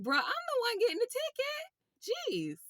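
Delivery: high-pitched incredulous retort; mock-exasperated, voice shooting way up; retold exasperation. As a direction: protest in a high, disbelieving squeal. Retold exasperated retort to panicking passenger.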